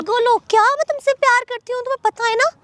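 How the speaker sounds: exasperated pleading. Acted. Bhabhi pleading her love.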